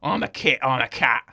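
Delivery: lightly voiced thuggish cat; Vinnie Jones' cat. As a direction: menace him like a hard man. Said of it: "Vinnie Jones' cat is like".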